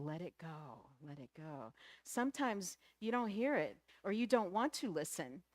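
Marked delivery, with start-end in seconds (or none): 0.0-1.7 low, soft nudge; soft, lowered, easy urging; gentle prompting. Acted. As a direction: murmur it like an inner voice. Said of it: "Sometimes it's gentle".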